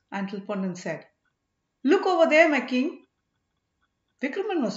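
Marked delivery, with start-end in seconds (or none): 1.8-3.1 high, loud alert call. A narrator's reading. Ponnan alerting the king to men by the road, calling out. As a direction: call his attention sharply.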